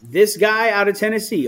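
normal talking voice

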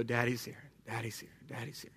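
low, soft reassuring daddy murmur; hushed, tender reassurance, voice dropped low; soothing dad. Retold or imitated. Dad comforting his young son, soothing.

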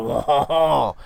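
mock French "wah" sound; exaggerated French-accent noise. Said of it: "they get so, like, stereotyped".

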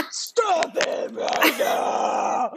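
long drawn-out, strained mock scream; guttural scream. Mother retelling her scream at jumping kids, guttural drawn-out scream. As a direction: stretch it into a hoarse, unhinged scream held under full volume. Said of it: "screamed a more guttural, insane".

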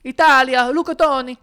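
loud, high "Italia, look at Tony"; loud mock Italian bravado; mock Italian-American. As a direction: ham up a proud Italian-American fan. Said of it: "boxing gloves hanging from their rear view".

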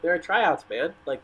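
ordinary talk, nothing marked

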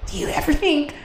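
high, airy mock-mystical voice; imitating a dreamy wind-listener reel. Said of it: "that reel where it's like".

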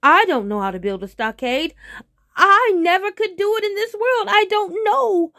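loud, climbing to a high wail; alarmed wail. Acted. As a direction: wail helplessly as the panicking tiger. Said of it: "The tiger became very alarmed".

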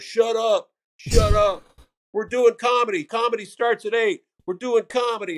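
high-pitched mock shouting; imitated yelling back. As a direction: yell back like a flustered bar owner. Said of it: "he just starts yelling back".

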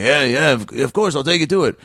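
lightly voiced gruff Wolverine; a hint of a gruff snarling Wolverine. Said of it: "That was my Wolverine voice".

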